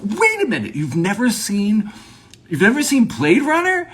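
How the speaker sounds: high, stunned mock disbelief; incredulous. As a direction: imitate a friend who can't believe it. Acts out stunned movie fan.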